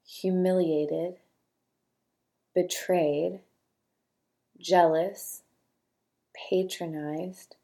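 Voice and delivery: quiet, unhurried reading of single words with long pauses; soft list reading. Acted. Host reading feeling words aloud for listeners to note, soft and slow. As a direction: let each word land softly, one at a time.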